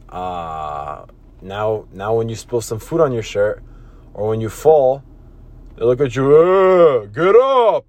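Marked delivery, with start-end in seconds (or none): 6.3-7.8 a curt, louder, impatient brush-off; dismissive grown-up. Retold or imitated. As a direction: bark it like you're tired of the kid. People now dismissive to the kid.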